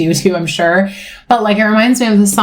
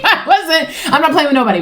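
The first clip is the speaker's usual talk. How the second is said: higher pitch, words riding on laughter; laughing while talking; 8 semitones higher than usual. Real, not acted. Joking about telling her boss off, laughing.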